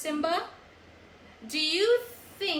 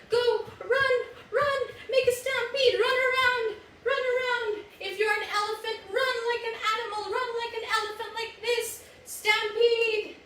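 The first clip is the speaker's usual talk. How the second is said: excited cheering; 9 semitones higher than usual. Real, not acted. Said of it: "leading kids in stampede game".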